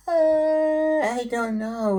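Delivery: put-on high, very slow mumbling child; reluctant kid voice. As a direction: drag it out, unwilling.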